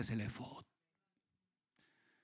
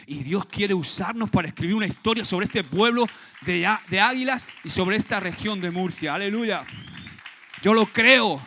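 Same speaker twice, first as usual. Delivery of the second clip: fiery preaching; 5 semitones higher, 10 dB louder than usual. Real, not acted. Preaching at full force.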